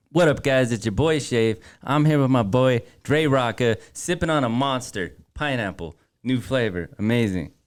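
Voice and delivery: energy drink promo. Pretend. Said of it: "You want me to do a promo right now?".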